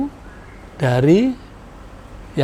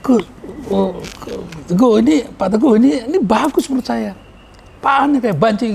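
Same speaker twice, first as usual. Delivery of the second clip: high, mincing mock of an effeminate voice; sissy voice; 9 semitones higher than usual. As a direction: squeal it up high like a camp caricature. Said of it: "kayak suara kayak banci nih".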